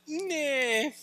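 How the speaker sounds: a high, loud, careless nah; dismissive nah. Pretend. Pastor mocking his own lazy devotions with a dismissive nah.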